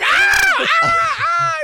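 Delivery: loud high pained cries; screaming ah. Retold or imitated. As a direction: yell it out in pain. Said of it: "He's like".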